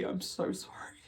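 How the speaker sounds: whispered through tears; barely-voiced, choked-up apology; tearful whisper. Real, not acted. Vlogger apologising to viewers who feel this daily, tearful whisper. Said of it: "I've been crying a lot today".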